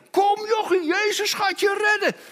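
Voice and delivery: hoog, overdreven zoetsappig geroepen; spottend nagedaan. Retold or imitated. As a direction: speel de huichelachtige trooster. (spottend nagedane troosters, hoog geroepen)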